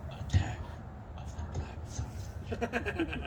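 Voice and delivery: hushed, slow movie-title whisper. Pretend. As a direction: breathe the title like a trailer. Title announced as joke.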